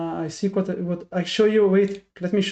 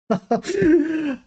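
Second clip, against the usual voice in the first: rueful laugh; 8 semitones higher than usual. Real, not acted. Laughs at own mistake.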